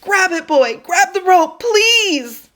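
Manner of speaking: rising, loud, frantic begging; desperate high pleading. Acted. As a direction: beg with everything left.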